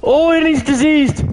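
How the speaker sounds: high alarmed yelp; panicking gamer. Real, not acted. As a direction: squeal as the monster hits. Attacked by creature.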